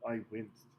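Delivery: ordinary delivery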